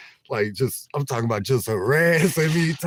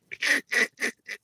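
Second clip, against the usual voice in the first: sudden high amused laugh; real high-pitched laugh; high squealing laugh; 13 semitones higher, 3 dB quieter than usual. Real, not acted. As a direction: crack up at the memory. Laughing about being roasted.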